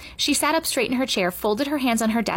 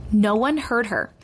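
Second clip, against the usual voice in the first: ordinary delivery, nothing marked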